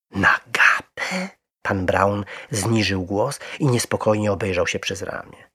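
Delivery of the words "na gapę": high, slow rising question; startled echo. A narrator's reading. Mr Brown echoing the bear's confession, taken aback. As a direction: repeat it in drawn-out disbelief.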